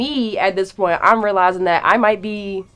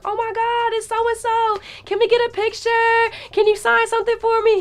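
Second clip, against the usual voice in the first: very high squealing fan voice; excited fan girls. Retold or imitated. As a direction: gush like starstruck girls. Fans spotting a celebrity.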